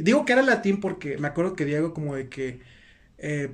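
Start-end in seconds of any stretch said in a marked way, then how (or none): none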